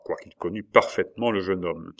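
ordinary delivery

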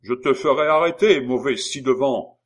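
loud, high-pitched outburst; angry threat. A narrator's reading. Cobbler threatening the sergeant with arrest, angry shout. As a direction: threaten at the top of your voice.

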